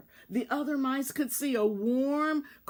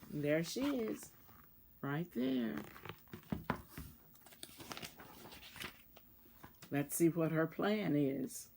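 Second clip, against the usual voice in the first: quiet aside; 6 semitones lower, 9 dB quieter than usual. Real, not acted. Aside showing the pictures.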